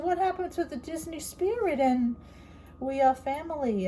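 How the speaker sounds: high, sing-song mock of Disney cheer; mock disbelief. Quoting her reply to the niece about Disney spirit, high mock cheer. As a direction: lift it up like a theme park slogan.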